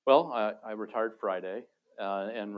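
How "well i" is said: usual speaking voice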